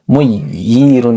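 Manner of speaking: মুইয়িরুন drawn out slowly, each syllable clear; slow demo word. Shown as a demonstration. Teacher showing students a word with tashdid, slowly. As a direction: say মুইয়িরুন slowly so they hear it. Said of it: "দেখেন, এটা দেখেন".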